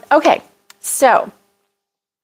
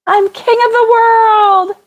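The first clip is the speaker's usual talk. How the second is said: high, drawn-out triumphant cry; exultant, high-pitched film line, last word stretched; movie-quote holler; 12 semitones higher, 3 dB louder than usual. Acted. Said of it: "I have to say it like that".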